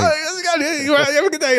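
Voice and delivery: high-pitched pestering chatter; hammed-up questions fired right in your face; overfriendly stranger. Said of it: "he comes out and goes".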